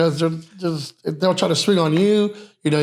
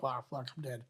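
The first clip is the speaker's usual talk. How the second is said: hushed resigned mutter; 5 semitones lower, 21 dB quieter than usual. Retold or imitated. Facing a gun, muttered.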